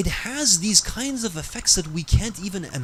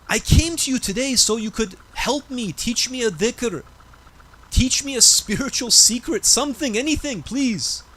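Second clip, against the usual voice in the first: desperate pleading; 7 semitones higher than usual. Retold or imitated. Young man begging Rajab Ali.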